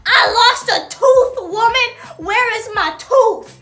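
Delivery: loud, high, slow tearful yelling at the teacher; crying shout. Retold or imitated. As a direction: wail at her, desperate and loud. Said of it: "I'm crying right now".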